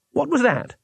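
startled, high cry; startled Two Flower. A narrator's reading. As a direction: jump at the sound. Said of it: "said Two Flower".

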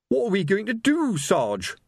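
high anxious wail. A narrator's reading. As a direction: whine it in panic. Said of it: "wailed Colon".

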